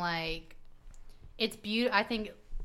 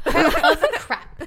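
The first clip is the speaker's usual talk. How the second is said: higher, louder, slow laughing repeat; 7 semitones higher, 9 dB louder, slower than usual. Real, not acted. Host echoing her cohost's joke, laughing loudly. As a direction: crack up as you echo it.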